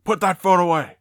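imitated sharp telling-off; rough usher. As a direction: scold like an usher running up. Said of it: "they were really being rough".

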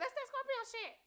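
high squeaky mimic of her; high imitating voice. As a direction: pitch up and mock her. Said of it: "She be like".

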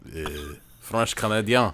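put-on French Canadian; French Canadian accent. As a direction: say it like a mock Québécois. Co-host plays along as French Canadian.

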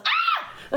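very high mock scream of fright; startled scream. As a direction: shriek as if a witch jumped out. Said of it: "And he goes".

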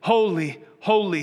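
angels calling out. Acted. Preacher voices angels praising God, calling out. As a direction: call each word out like a proclamation. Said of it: "the angels are calling out".

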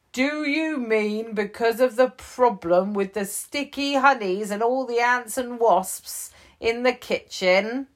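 quizzing the bear; chief voice. Acted.

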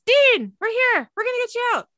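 high, loud shout, slowing down; yelling. Retold or imitated. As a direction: shout to a brother trapped below. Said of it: "Sam yells".

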